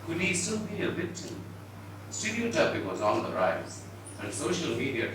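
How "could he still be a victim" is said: ordinary delivery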